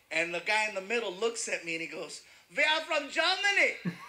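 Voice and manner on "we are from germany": high, emphatic mock German accent. Front-row guy tells comedian his origin, German accent. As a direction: answer proudly in a thick German accent.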